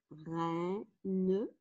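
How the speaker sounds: "reine" slowly in two beats, rei-ne; split into syllables. Shown as a demonstration. Teacher counting syllables for pupils, "reine" split as rei-ne. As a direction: sound out "reine" syllable by syllable, final e voiced. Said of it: "j'ai deux syllabes".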